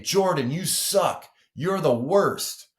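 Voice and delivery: high-pitched, drawn-out mock put-down; mock insult. Athlete tearing into teammate Jordan after a miss, hammed-up scorn. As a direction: stretch it out slowly as an exaggerated jab.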